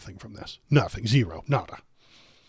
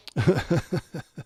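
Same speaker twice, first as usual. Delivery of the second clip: laugh; 6 semitones higher than usual. Real, not acted. Laughs after the joke.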